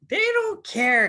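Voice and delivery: high, loud, slow insistence; they don't care, nearly shouted; emphatic cry. Real, not acted. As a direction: drive the point home hard. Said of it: "emphatic peak of speech".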